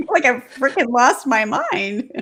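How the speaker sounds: high, amused exasperation with laughs breaking in; laughing exasperation. Real, not acted. Guest confessing her brain fog, laughing exasperation. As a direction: laugh at your own muddle as you say it.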